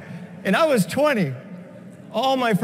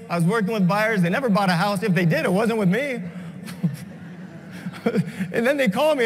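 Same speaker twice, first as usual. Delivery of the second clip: ordinary talk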